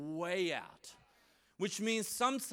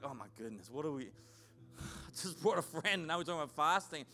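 much lower and softer, a sighing aside; mock dismayed guest; 6 semitones lower, 3 dB quieter, faster than usual. Newly invited friend hearing about fasting, low dismayed mutter. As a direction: play the startled newcomer, muttering under the breath.

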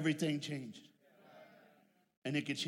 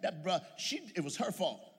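usual speaking voice

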